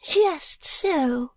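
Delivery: put-on high, slow, whispery old lady confiding; fluttery elderly lady, hushed and high; Miss Flite voice.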